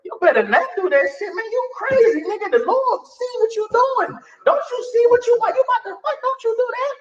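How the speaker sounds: high, urgent scolding conscience voice; squeaky pleading conscience, hammed up; inner voice of conscience. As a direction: warn yourself off like a nagging inner voice. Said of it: "there's something in you like".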